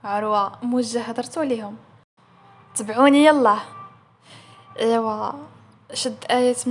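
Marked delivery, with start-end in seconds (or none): 2.7-3.6 loud, firm order; commanding. Acted. Aroua ordering the two employees to follow, commanding. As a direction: call it out like a boss giving orders.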